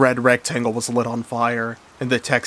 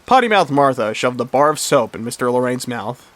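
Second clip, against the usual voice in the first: plain reading voice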